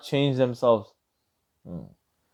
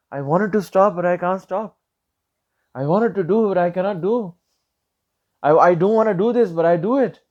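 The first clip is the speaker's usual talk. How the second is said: higher, whining imitation of a weak-willed person; high-pitched mock complaint about being unable to stop; mock helpless whine; 5 semitones higher than usual. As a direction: mimic someone making excuses, pitched up. Imitating people nowadays complaining.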